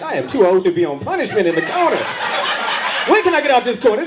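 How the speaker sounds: whiny grown man. Retold or imitated. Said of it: "I'm like".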